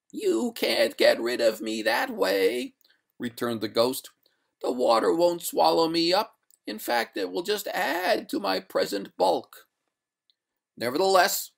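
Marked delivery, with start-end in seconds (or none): 0.1-2.8 high, slow drawn-out ghost; ghostly lady. A narrator's reading. Ghost voiced, arguing with master. 4.6-9.7 high-pitched ghost voice; ghostly lady. A narrator's reading. Ghost voiced, arguing with master. 10.8-11.5 higher, louder insistence; firm. A narrator's reading. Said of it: "said the master firmly".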